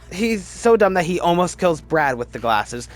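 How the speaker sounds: ordinary talk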